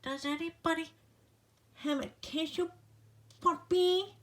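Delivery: high, stuffy little flea voice; squeaky stuffed-up flea begging; garbled flea plea. Acted. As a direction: plead like a tiny sick flea with a blocked nose. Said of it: "his garbled plea".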